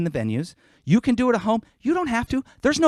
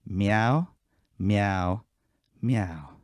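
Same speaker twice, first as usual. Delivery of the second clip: low, deadpan meows; soft low meow as a dance cue; demonstrated meows; 13 semitones lower, 10 dB quieter, much slower than usual. Shown as a demonstration. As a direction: meow three times, low and evenly spaced. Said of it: "the dance goes like this".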